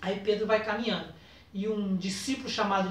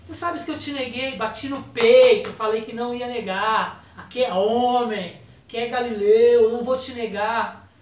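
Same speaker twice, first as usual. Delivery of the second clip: louder, swelling outcry of remorse; impassioned confession; 7 dB louder than usual. Retold or imitated. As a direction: pour out the guilt, rising in force. Peter confesses his boast.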